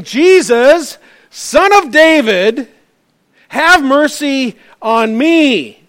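loud pleading cry, slow and stretched; calling out. Acted. Blind beggar calls over the crowd to Jesus, loud slow plea. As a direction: call out across the crowd for mercy. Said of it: "So he called out".

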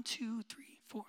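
counting rows under the breath; a parent counting choir kids in a whisper. Retold or imitated. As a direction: whisper the numbers to yourself.

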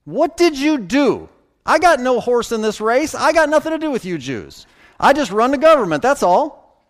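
high-pitched, exasperated acted tirade; getting mad. Preacher voices Pilate disowning the dispute, getting mad. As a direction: wash your hands of it, worked up. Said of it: "gotta hear it in his voice".

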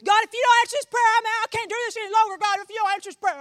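high, loud, fast mock prayer of a quitting believer; preacher mimicking a believer who threatens to quit on God, loud and fast; desperate ultimatum prayer. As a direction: shout it like a fed-up believer giving God an ultimatum.

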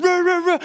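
high loud imitated barking; dog barking. Pastor imitating the dog charging him. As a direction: bark like a charging German Shepherd.

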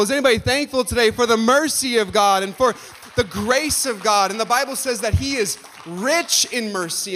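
ordinary delivery

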